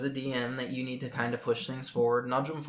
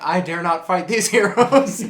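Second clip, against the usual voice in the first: slow, hammed-up cowardly villain, louder; mock the boss who backs down; grand, slow fake cowardice; 5 semitones higher, 11 dB louder than usual. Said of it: "He was like".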